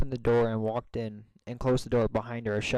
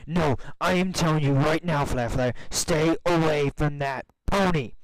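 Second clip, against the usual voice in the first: loud, high, furious yell at her friend; angry friend yelling at Flare Flare to stay away; angry shout; 5 semitones higher, 5 dB louder than usual. A narrator's reading. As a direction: shout the warning in real anger. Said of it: "said with angry eyes".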